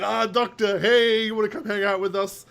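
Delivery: hammed-up cheery call to the Doctor; imitating a chummy host; friendly mock invite. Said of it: "He's like".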